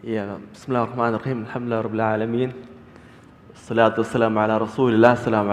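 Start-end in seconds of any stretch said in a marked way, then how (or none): none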